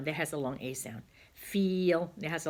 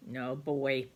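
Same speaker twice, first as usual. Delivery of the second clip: ordinary talk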